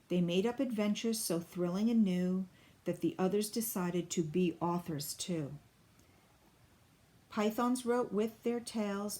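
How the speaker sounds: usual speaking voice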